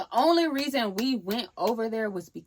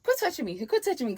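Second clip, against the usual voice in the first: higher-pitched annoyed protest; retold irritation; 7 semitones higher than usual. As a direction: act out irritated pushing-away. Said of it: "I'm very much irritated".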